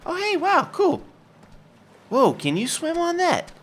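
very high, amazed cartoon voice; high excited character voice. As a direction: marvel like a little creature.

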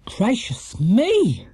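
high, drawn-out exclamation; shocked cry. A narrator's reading. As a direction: cry out slowly in astonishment. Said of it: "cried Missus Bird".

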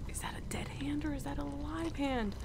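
hushed, unvoiced whisper. Real, not acted. Player creeping past a hand in the mud, whispering. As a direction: whisper so nothing hears you.

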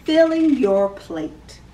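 ordinary talk, nothing marked

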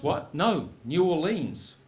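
ordinary delivery

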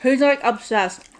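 eating between words; chewing while talking. Real, not acted. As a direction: mouth partly full of food. Seen 1: She ate fries just before and handles fries while talking.

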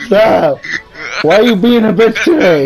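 high, loud, half-laughing complaint; exasperated scolding. Real, not acted. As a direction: scold the horse through laughter. Scolding the horse through laughter.

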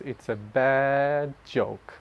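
slow, half-hearted southern accent on the punchline. Shown as a demonstration. Sharing group member tries a southern accent on the punchline, slow and half-hearted. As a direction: try a southern accent and let it fall short. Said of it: "I tried to do some southern, uh, accent there".

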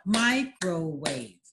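"Microwave" said slowly as "mi-cro-wave", one beat per clap; clapped out syllables. Shown as a demonstration. Teacher to child, clapping out syllables slowly. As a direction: clap out "Microwave" in three slow beats. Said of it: "Or clap it out".

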